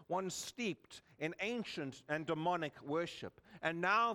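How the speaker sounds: ordinary delivery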